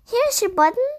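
high, eager call of discovery; excited find, voice lifted high; crying out. A narrator's reading. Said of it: "cried Frog".

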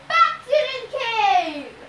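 high, drawn-out monster shout; shouted order. A narrator's reading. Cyclops sends his captive back inside, shouting. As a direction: bellow it slowly as a Cyclops. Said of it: "he shouted".

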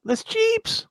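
incredulous. Real, not acted. Incredulous echo of mispronunciation.

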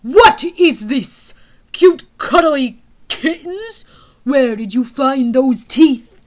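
very loud, slow furious roar. Acted. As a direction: roar in outrage at the guard. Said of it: "The General roared".

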